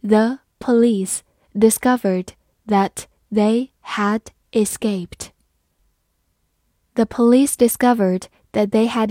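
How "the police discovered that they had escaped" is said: slow, careful reading. Shown as a demonstration. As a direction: read it slowly for learners. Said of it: "好，慢慢来读".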